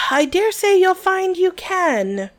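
slow, clenched-jaw reply; through gritted teeth. Acted. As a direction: force words past gritted teeth. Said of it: "through tightly gritted teeth".

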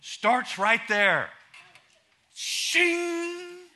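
emphatic punch. Acted. Emphatic preaching.

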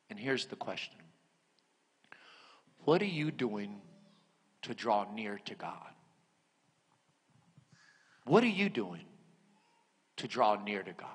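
hushed and intimate pastoral challenge. Acted. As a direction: bring the room down to a near whisper. Quiet preaching close.

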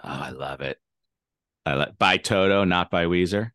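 normal talking voice

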